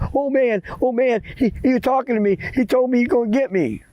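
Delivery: put-on high, stammering boy's report; acted high-pitched fright; panicked boy. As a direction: blurt it out high, scared and repetitive. Scared boy reports to grandma.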